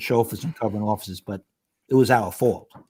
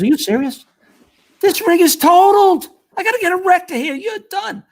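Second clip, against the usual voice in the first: high, loud disbelief; incredulous; 7 dB louder than usual. Retold or imitated. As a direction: sound floored at the wreck. Shop guy retold in disbelief.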